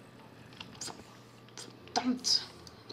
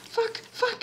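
panicked cursing; 13 semitones higher than usual. Real, not acted. Panicked swearing continues.

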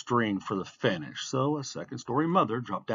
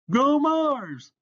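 high, loud fan's holler; called cheer; 6 dB louder than usual. A narrator's reading. Onlooker cheering a racer before the start, shouted. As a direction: cheer on the runner from the sidelines. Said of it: "someone else called".

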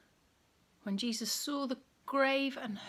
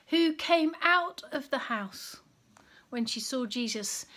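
ordinary delivery, nothing marked

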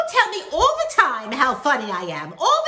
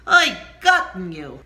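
anguished cry; 5 semitones lower, 8 dB louder than usual. Shown as a demonstration. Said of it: "that's how you build on the word oi".

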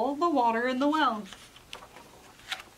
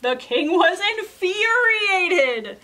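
higher, louder, slow telling; dramatic build; 6 semitones higher, 8 dB louder, slower than usual. A narrator's reading. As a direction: draw out furious for effect. Narrator builds the king's rage.

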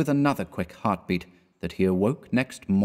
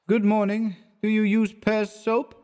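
much higher, woozy tipsy voice; vague drunk greeting; 12 semitones higher than usual. A narrator's reading. Drunk Clifford greets his friend, higher and woozy. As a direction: play him drunk and dreamy. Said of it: "with a vague wave of his hand, and a vaguer smile".

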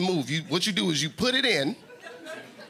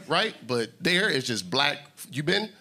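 ordinary talk

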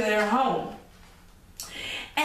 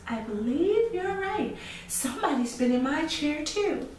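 higher mama bear voice; 8 semitones higher than usual. Acted. Mama bear agreeing about the chair, higher voice.

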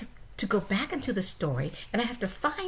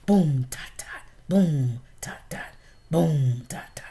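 vocal drumbeat; 4 semitones lower, 5 dB louder than usual. Acted. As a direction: beat the drum with your voice. Drum rhythm voiced.

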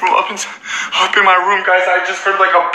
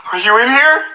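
high, raised questioning call; 8 semitones higher, 4 dB louder than usual. Real, not acted. Vlogger searches room for dead friend, calling out. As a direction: call into a room, pitch rising at the end.

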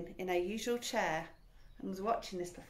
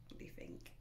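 whispering; 15 dB quieter, faster than usual. Real, not acted. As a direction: drop to a secret whisper. Whispered aside to the listener.

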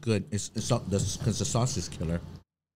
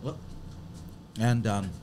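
talking while chewing; mouth full; 6 dB quieter than usual. Real, not acted. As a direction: chew and talk at once. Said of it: "sorry to talk with my mouth open".

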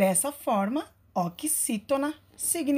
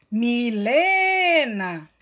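loud call with "le" in "Milena" drawn out long and slow; calling out at the gate; 6 dB louder, much slower than usual. Shown as a demonstration. Teacher calls Milena at the gate to show the stress, loud stretched call. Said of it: "estratégia do chamando no portão".

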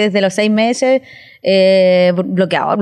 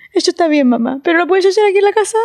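put-on lightly higher, quick, laughing kid voice; a hint of a know-it-all child; 6 semitones higher, faster than usual. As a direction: answer like a cheeky little girl. Daughter's clever reply imitated. (leve voz de niña sabihonda)

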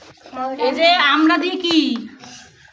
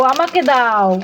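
ordinary delivery

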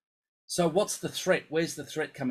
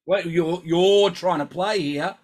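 high, loud alarmed brain voice; the brain yelling in alarm when the playing starts; voice of the panicking brain; 4 semitones higher, 8 dB louder than usual. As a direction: play the brain hitting the panic button. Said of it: "the brain goes".

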